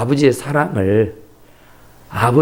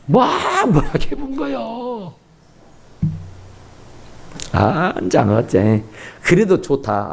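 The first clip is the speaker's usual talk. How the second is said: ordinary talk, nothing marked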